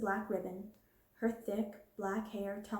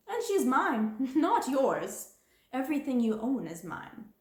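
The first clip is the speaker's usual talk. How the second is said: higher, louder, with a cruel laugh; gloating; 4 semitones higher, 5 dB louder than usual. Acted. Cruel gloat. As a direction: headmistress telling Sarah everything is hers, gloating. Headmistress claims the doll.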